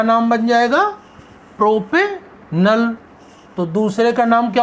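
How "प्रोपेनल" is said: Propanal drawn out, paused before नल; slow dictation. Shown as a demonstration. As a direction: split propanal slowly into प्रोपे and नल. Teacher dictates name in parts.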